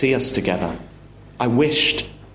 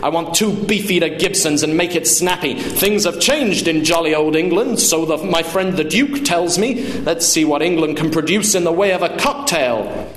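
raised-pitch impatient demand; pompous, irritated ordering; angry; 5 semitones higher than usual. Acted. Father demands cocktails from waiter, angry. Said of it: "my father said angrily".

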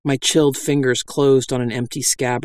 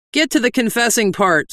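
cried out; 9 semitones higher than usual. A narrator's reading. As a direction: call it from the crowd. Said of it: "someone cried".

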